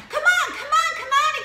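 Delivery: high, loud urgent "come on"; urgent calling. Retold or imitated. Son urging brother to slide under, high urgent calling. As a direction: hurry a friend under the gate. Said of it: "he kept saying".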